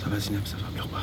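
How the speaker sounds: very quiet, soothing reassurance; soft consoling. Acted. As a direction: hush a crying friend gently. Soothing a crying companion. (réconfort murmuré tout bas)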